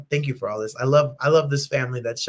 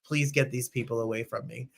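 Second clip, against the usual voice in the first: mom's unspoken thought; 4 semitones lower, 7 dB quieter than usual. Retold or imitated. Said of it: "She looks like".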